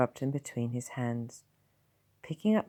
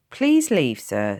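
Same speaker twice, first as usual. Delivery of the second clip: sharp; 11 dB louder, slower than usual. Acted. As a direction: cut him off sternly. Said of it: "said the sister sharply".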